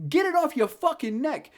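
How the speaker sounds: high loud yell; exasperated yell. Real, not acted. Yelling at the movie character, exasperated. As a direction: yell at the screen.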